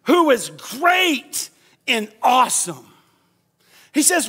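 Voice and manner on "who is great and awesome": louder, higher, slow; said together. Shown as a demonstration. Preacher leading the congregation to recite, loud and slow. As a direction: proclaim it with the crowd. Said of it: "Come on, everybody".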